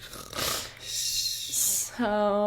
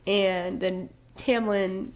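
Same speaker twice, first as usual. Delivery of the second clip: usual speaking voice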